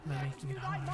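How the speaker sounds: low, dropped comic voice; deadpan the silly name; lightly mock voice. Shown as a demonstration. Streamer repeating the joke to chat, low comic voice. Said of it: "without cracking up again".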